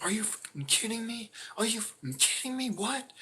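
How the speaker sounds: high squealing mock outrage, far above his own voice; shocked disbelief. Roommate hearing his tire was stolen, shrieking disbelief. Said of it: "I can't explain his full reaction".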